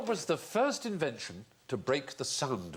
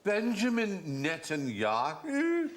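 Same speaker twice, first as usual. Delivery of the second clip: Connolly-style riff on the name; imitating Billy Connolly on the phone; slow drawn-out Netanyahu; 7 semitones higher, slower than usual. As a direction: stretch "Benjamin Netanyahu?" slowly, higher and questioning. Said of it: "He said".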